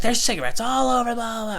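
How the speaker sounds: high, screechy neighbor impression; mock maniac screaming. Neighbor confronting him over cigarettes, mock maniac screaming. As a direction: mimic her ranting way up high. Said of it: "screaming out loud like a maniac".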